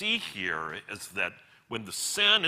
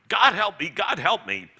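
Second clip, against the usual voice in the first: high, loud desperate whimper; 7 semitones higher, 8 dB louder than usual. A narrator's reading. Ghost breaking down before the killing, loud whimpering plea. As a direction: plead for help in a raised, whimpering cry. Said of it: "but ended whimpering".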